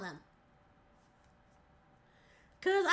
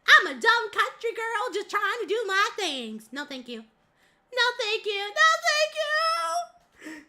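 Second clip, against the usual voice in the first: high, swooping country bumpkin voice; ditzy, high-pitched Southern caricature, sing-song at the end; dumb country girl; 6 semitones higher, 4 dB louder than usual. Pretend. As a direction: play a hammed-up, dim-witted country girl. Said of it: "very idiotic Bessie, Bessie characters".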